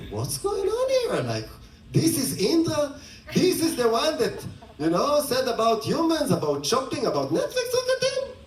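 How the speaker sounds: high, outraged disbelief; shocked gods. Retold or imitated. Gods watching Indra in mud, shocked and high. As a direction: gasp at the fallen lord, voice jumping up.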